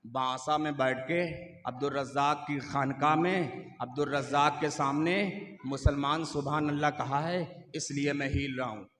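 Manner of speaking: low, soft, solemn voice of the throne. Acted. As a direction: speak as the trembling divine throne, low and hushed. Divine throne speaks.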